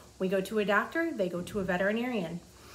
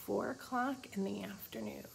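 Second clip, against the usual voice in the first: breathy whispered aside about the kitty; 8 dB quieter than usual. Real, not acted. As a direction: whisper it while showing the page. Whispered time reading.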